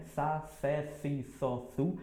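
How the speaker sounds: sa, sé, si, só, su with the tongue between the teeth; soft th syllable drill; lisped s drill. Shown as a demonstration. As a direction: drill the syllables with a lisp, softly. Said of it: "coloco mais entre os dentes".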